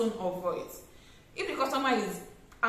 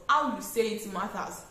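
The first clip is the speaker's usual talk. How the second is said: ordinary talk, nothing marked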